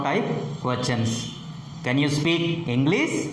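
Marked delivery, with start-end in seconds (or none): 1.8-3.3 steep upward turn on "English"; rising tone. Shown as a demonstration. Teacher modelling yes or no type questions, rising tone. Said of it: "The rising tone is used with the".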